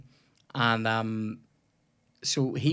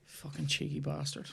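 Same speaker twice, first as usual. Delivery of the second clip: muttered curse; 9 dB quieter than usual. Real, not acted. Muttering at opponent in game.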